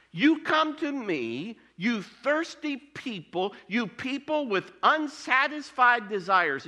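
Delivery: high, slow preacher's call to the thirsty; preaching. Acted. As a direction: plead with them to come, slowly.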